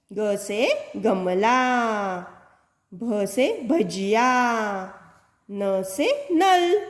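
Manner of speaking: put-on each word read out slowly, syllable by syllable, to children. Shown as a demonstration. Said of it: "teacher reads picture words to children". (बच्चों के लिए धीरे-धीरे पढ़ना)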